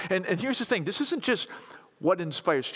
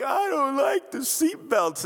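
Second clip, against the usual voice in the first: put-on whiny toddler; 8 semitones higher than usual. Toddler complaining about stroller straps.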